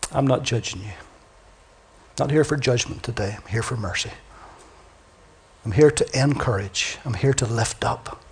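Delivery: soft, low reassurance; God speaking mercy to a broken prophet, tender. Acted. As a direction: comfort a fragile man gently.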